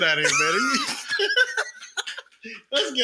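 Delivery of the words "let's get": high laugh breaking the words; laughing too hard to finish; giggling. Real, not acted. Trying to close the show, laughing high.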